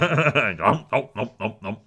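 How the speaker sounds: exaggerated greedy munching sounds; mock eating, nom by nom; chomping noises. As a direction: gobble it down noisily. Imitating the orc's greedy eating.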